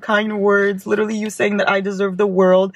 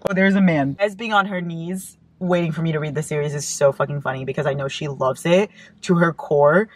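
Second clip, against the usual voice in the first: ordinary delivery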